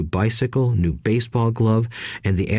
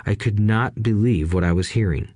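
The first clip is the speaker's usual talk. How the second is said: ordinary delivery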